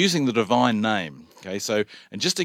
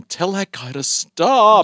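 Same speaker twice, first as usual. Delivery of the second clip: slow, higher, louder complaint; annoyed listener; 9 semitones higher, 6 dB louder, slower than usual. Retold or imitated. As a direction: play a fed-up caller. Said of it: "have people ringing and going".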